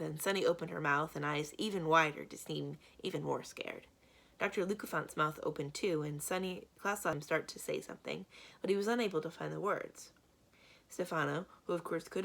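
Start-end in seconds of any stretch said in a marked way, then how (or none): none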